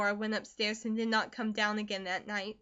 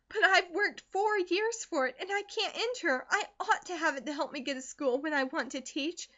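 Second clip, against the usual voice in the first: put-on much higher, earnest pleading girl; pleading daughter; 6 semitones higher than usual. Daughter begs mother to understand, high and pleading. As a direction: plead with everything you have. Narrator voices Elnora.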